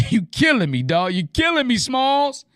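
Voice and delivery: high-pitched playful protest after a laugh; jokey, rising exasperation; joking mock complaint. Acts out a joking line to him.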